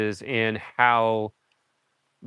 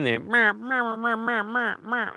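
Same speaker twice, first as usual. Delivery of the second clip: mimicked meowing; 9 semitones higher, 4 dB quieter, slower than usual. Imitating beaver sounds in the film.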